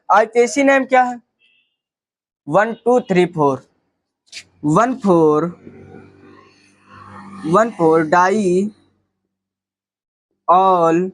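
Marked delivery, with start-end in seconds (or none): 2.5-11.1 measured, deliberate dictation; slow locant count. Shown as a demonstration. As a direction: slowly spell out each number. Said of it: "teacher spells out locants slowly".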